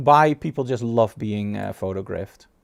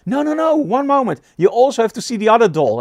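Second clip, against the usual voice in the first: high, louder eager protest; imitating an excited shopkeeper showing his dolls; 8 semitones higher, 6 dB louder than usual. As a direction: insist eagerly, pitched up high. Excited shop owner quoted.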